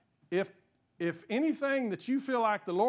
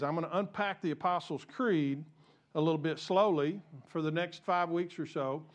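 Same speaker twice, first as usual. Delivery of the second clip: usual speaking voice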